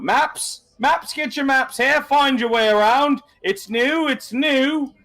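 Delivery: high-pitched street vendor calling out his wares; drawn-out high sales calls, each rising; yelling map hawker. Acted. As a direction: hawk maps to the crowd in a high, ringing cry. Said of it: "sort of, uh, yelling out".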